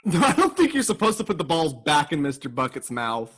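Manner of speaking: ordinary talk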